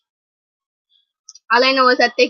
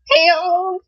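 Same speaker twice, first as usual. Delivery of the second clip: excited; 7 semitones higher than usual. Real, not acted. Fan spotting idol in video.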